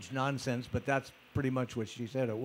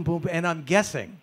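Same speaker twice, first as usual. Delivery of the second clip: ordinary talk, nothing marked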